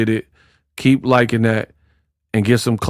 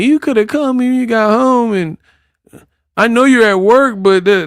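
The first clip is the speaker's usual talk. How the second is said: put-on clingy girlfriend voice; 9 semitones higher than usual.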